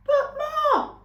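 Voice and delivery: a gosling squeaking a protest; young gosling. A narrator's reading. Young goose protesting to his mother, high and squeaky.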